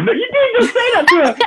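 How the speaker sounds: high squealing disbelief with a laugh; laughing shriek of mock outrage; shocked squeal. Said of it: "I said".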